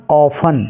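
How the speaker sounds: "ऑफन" with no t after the f; "ऑफन", just f and -an; silent T. Shown as a demonstration. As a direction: correct it to "ऑफन", T dropped. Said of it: "टी इसमें silent".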